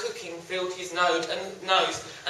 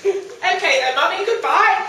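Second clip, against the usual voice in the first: put-on whiny child voice; 9 semitones higher, 5 dB louder than usual. Son cheerily leaves.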